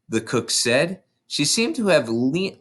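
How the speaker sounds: usual speaking voice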